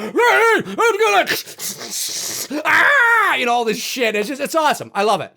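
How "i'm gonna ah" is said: high, slow panic then a cry; mother screaming at the door; panicked parent. Retold or imitated.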